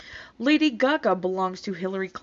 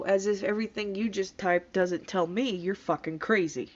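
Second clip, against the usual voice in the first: usual speaking voice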